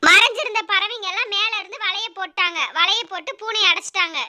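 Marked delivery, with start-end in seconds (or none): none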